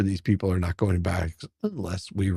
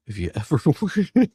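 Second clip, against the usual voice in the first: higher voice broken by a real laugh; breaking into laughter; 5 semitones higher, 4 dB louder than usual. Real, not acted. Host introducing children's drawings video, breaking into laughter. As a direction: lose it laughing halfway through the question.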